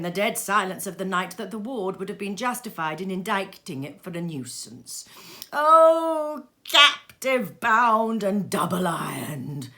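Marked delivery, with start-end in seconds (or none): 5.5-9.7 loud drawn-out slow wail; phantom's cry. Acted. Phantom crying out to Scrooge, loud slow wail. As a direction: howl it in despair. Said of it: "cried the phantom".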